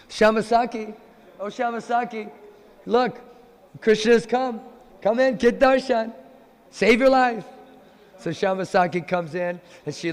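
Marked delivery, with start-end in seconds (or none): 0.1-7.4 louder, higher welcoming call; a friend calling the lovesick girl inside. Acted. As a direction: call her in across the room. Lalita calls the visitor in.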